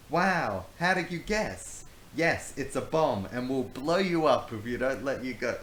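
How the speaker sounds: loud high fake surprise; mock-amazed bluff. Tim bluffing Brownbeard about the bomb, loud mock surprise. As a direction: play along with the lie.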